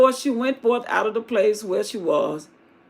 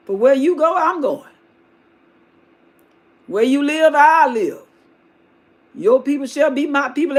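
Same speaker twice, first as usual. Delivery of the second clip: louder, higher impassioned declaration; impassioned vow; 3 semitones higher, 7 dB louder than usual. Acted. Ruth vowing to stay with Naomi, loud and impassioned. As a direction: proclaim it with full conviction.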